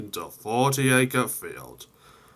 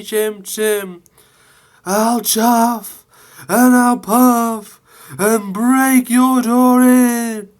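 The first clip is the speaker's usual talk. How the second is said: very high, loud, slow big bad wolf taunt; Toby defiant; 12 semitones higher, 9 dB louder, slower than usual. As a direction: bluster the rhyme back at the ghost. Toby answers the ghost, wolf rhyme.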